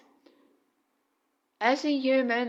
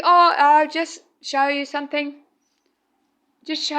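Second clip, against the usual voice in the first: ordinary talk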